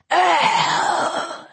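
high hissing cry; hissing shout. A narrator's reading. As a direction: hiss it out, shouting. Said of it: "Shu hissed".

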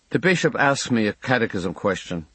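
plain reading voice

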